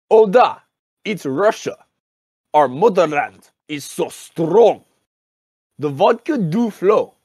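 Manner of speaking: solemn mock-Russian haiku, long pauses between lines; haiku recital. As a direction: recite it line by line in a Russian accent. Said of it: "And I begin".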